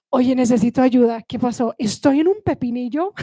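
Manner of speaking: mock panic. Teacher imagines asking strangers for help, mock panic. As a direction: beg for help in fake distress. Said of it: "I would walk around going".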